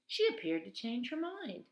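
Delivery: plain reading voice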